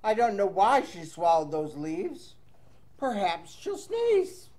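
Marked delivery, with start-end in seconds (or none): none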